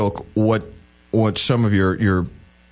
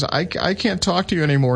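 put-on a hurt old aunt, pitched up; aunt's voice; 8 semitones higher than usual. Aunt cutting off her Republican nephew, higher hurt voice.

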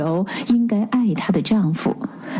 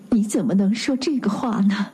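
usual speaking voice

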